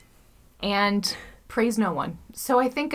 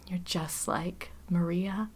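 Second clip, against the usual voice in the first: slow, hushed imitation of a child; awed whisper; 2 semitones lower, 8 dB quieter, slower than usual. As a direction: whisper it slowly like an amazed kid. Retold little boy's awed remark.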